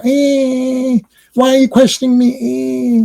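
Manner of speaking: very high, put-on whine; mock temper tantrum. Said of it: "some kind of temper tantrum".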